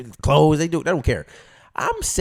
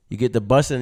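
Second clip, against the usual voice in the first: normal talking voice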